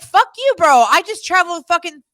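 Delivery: high, loud retold tirade; retold angry outburst. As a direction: replay the outrage at full pitch and volume. Retelling her anger at his lateness.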